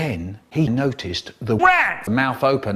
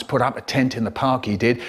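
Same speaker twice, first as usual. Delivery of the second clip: plain reading voice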